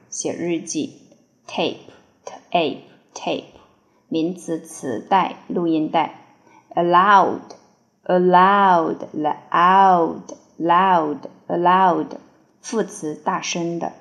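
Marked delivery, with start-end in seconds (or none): none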